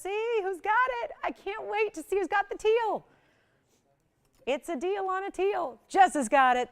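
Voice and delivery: high, eager and bright; excited, can't wait. Real, not acted. Excited waiting for buyer.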